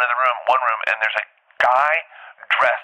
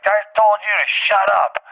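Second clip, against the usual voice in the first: much higher, mock-angry little DJ; angry outburst; 10 semitones higher, 3 dB louder than usual. DJ silencing the comic mid-show, angry outburst. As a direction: imitate an irate heckler, pitched way up.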